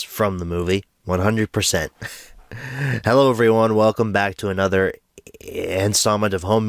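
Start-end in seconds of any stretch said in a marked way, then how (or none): none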